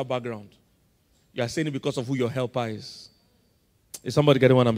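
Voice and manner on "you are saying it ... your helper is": ordinary talk, nothing marked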